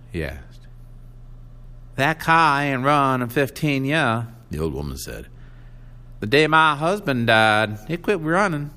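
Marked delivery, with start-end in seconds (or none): 2.0-8.7 put-on old country woman voice. A narrator's reading. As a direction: speak it like a stubborn old farm woman.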